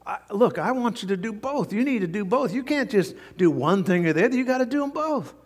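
high, insistent acted scolding; retold exasperated telling-off, climbing in pitch; upset boss. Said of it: "So he's now upset".

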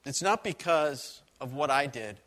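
usual speaking voice

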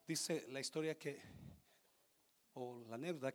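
normal talking voice

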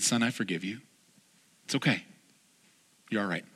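soft, low reassurance from a forgiving dad; gentle father. Retold or imitated. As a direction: forgive him softly, almost hushed.

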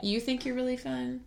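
normal talking voice

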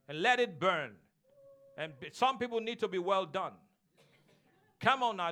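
fiery preacher stirring up the church; full-force preaching. Acted. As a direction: preach it hot and loud to the congregation.

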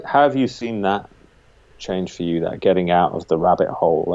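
ordinary talk, nothing marked

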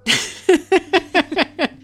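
loud high laugh breaking out; sudden loud laugh; burst of laughter. Real, not acted. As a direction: crack up laughing mid-reading. Laughs at own voicing.

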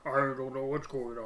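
imitating an absurdly deep, voice-changed mafia witness; disguised witness voice. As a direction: put on a comically deep, garbled anonymized voice. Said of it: "sounds like he's giving testimony against the mafia".

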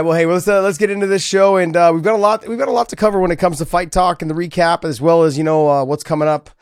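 host previewing the episode's topics; show rundown. Acted.

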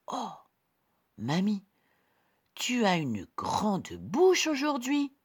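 put-on high, bright little girl. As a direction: play the small girl. Girl questions granny.

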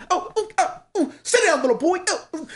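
high excited sportscaster yelps; hyped commentator. Retold or imitated. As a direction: cheer like a hyped reaction YouTuber. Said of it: "the guy's just like".

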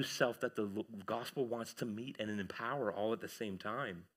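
hushed earnest sermon appeal; quiet intense preaching. Acted. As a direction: lean in and plead quietly.

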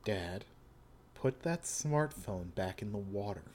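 put-on hushed, cold young man; quiet menacing son. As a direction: speak low and intense. Joshu voice again.

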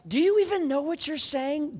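high-pitched incredulous mock outrage; indignant high-voiced demand; retold anger. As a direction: act out an angry challenge. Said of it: "atheist doctor laying on the bed is angry".

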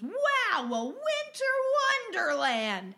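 high, slow, loud gasp of wonder; gushing wow. Retold or imitated. Teller imitates a too-happy customer, gushing. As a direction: stretch it out like a thrilled kid. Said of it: "he goes".

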